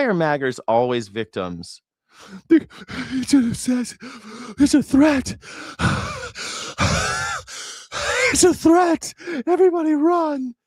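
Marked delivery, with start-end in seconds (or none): 2.5-10.6 high-pitched whiny panic mocking MAGA fans. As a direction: squeal it like a frightened victim.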